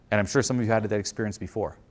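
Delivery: ordinary talk, nothing marked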